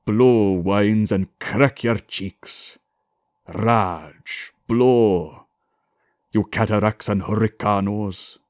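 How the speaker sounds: rolled R in Lear, harsh; shakespearean english. Shown as a demonstration. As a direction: roll every R harshly, Elizabethan style. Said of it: "Without a rolled R".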